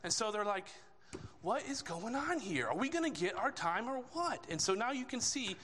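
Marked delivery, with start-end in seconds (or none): 1.4-4.5 higher-pitched, mock exasperated questioning; irritated. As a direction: act out mildly fed-up disciples demanding answers. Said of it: "They're starting to get irritated".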